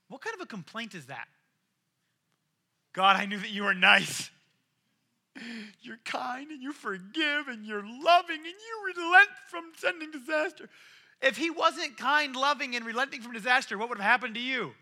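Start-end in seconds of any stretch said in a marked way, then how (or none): 2.9-10.7 whiny Jonah. Retold or imitated. Jonah sulkily complaining to God, mock whine.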